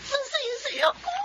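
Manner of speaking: tearful, voice breaking with happy tears; choked-up joyful sob; crying with joy. Acted. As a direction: cry through the happiness. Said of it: "Umiiyak na kagad".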